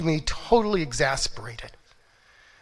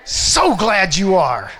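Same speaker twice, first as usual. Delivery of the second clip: joyful loud greeting; 4 semitones higher, 8 dB louder, slower than usual. Shown as a demonstration. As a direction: call it out loud and high, then the answer slow. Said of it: "I want you to say".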